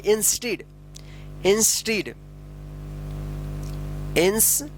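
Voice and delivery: Instead broken by a long pause, 'ins... teed'; syllable by syllable. Shown as a demonstration. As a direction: split instead into two separate pieces. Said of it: "instead पढ़ेंगे". (Instead को दो हिस्सों में बोलना)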